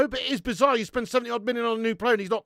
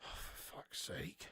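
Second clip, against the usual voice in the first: despairing whisper; 23 dB quieter, slower than usual. Real, not acted. Despair, muttered.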